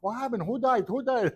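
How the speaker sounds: high, frantic questions; imitating the wife asking who died; panicked wife. Wife answering phone early morning, high and panicked. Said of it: "she was like".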